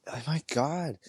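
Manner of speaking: high, imitated amazement; mock-amazed exclamation; retold amazed reaction. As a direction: mimic visitors wowed at the doorway. Visitors react to cooking smell.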